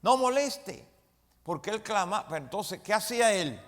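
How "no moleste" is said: a sharp, loud scolding, pitched high; rebuking. Retold or imitated. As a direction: hush the blind man, loud and high. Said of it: "muchos le reprendían".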